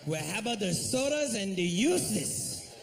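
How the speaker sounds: put-on a cashier struggling with the order in a Spanish accent; hammed-up Spanish-accented cashier; mock Spanish accent. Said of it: "You want something to drink?".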